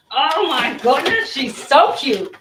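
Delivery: drawn-out, loud gushing over it; real excitement. Real, not acted. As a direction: gush slowly, leaning hard on each word. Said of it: "You know I'm excited when the voice goes down".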